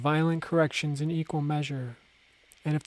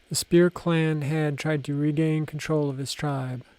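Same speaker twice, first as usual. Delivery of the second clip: plain reading voice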